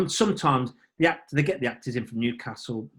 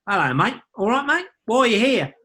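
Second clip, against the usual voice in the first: high-pitched mimicked Australian greeting; Australian accent; 8 semitones higher, 5 dB louder than usual. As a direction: mimic an Aussie traveller, pitched up. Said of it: "For example, an Australian go".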